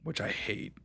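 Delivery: normal talking voice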